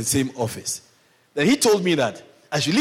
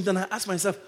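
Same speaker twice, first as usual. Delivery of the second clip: ordinary talk, nothing marked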